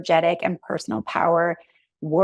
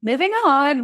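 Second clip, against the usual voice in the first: breezy dismissal; 7 semitones higher, 3 dB louder than usual. Retold or imitated. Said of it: "I'm not just like".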